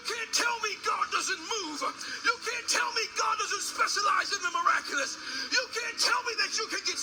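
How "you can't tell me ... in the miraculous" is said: ordinary delivery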